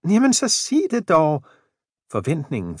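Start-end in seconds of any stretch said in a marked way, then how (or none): 0.1-1.4 put-on much higher, curious and impatient old wife; a high, eager old woman's voice; eager old wife Jane. A narrator's reading. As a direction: press him to tell, all eager.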